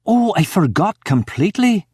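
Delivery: high-pitched flustered panic; panicked. A narrator's reading. Wife remembering her husband's glass of water, panicked. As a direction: blurt it in a sudden panic. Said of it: "says in a panic".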